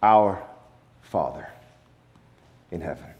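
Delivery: hushed correct reading after the wrong ones; tender reverent reading. Shown as a demonstration. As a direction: let the true name settle gently. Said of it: "What does he say?".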